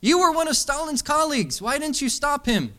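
high, loud heckler yelling from the crowd; angry audience heckler shouting; heckler's shout. Retold or imitated. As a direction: shout the accusation up at the stage. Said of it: "a shout from the heckler".

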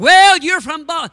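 loud, high, slow teasing call; mock loud jab. Fellow teasing a Baltimore man, a loud high jab. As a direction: belt out the jab slowly.

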